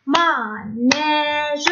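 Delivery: manège drawn out slowly as ma-nè-ge; syllable by syllable. Shown as a demonstration. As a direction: tap out manège, stretching every syllable. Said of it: "Tapez le mot".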